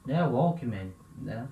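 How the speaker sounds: normal talking voice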